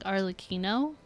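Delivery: ordinary delivery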